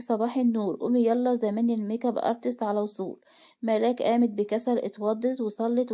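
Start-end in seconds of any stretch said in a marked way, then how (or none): none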